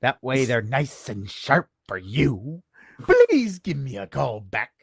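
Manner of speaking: creepy voicemail voice. Retold or imitated. Leaving a creepy voicemail for the association president. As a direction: menace him playfully into calling back. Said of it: "And that was the voicemail".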